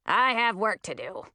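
put-on sour old witch grumble; mildly grumpy character voice; grumbling. A narrator's reading. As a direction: grumble it curtly, as an excuse. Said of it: "Ratinky grumbled".

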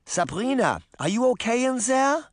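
put-on high, questioning old-woman character voice; grandmother's voice. A narrator's reading. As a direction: call through the door as a concerned grandmother. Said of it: "her grandmother said as she tapped".

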